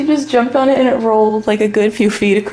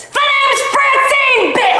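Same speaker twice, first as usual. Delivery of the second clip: high, slow shouted battle-cry intro; shouting Francine; 14 semitones higher, slower than usual. Acted. To the bratty kid, shouting her name. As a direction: announce yourself like a furious stranger.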